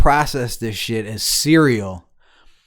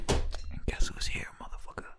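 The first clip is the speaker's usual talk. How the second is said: taunting whisper; 15 dB quieter than usual. Retold or imitated. Friend reenacting his return to an argument, taunting whisper.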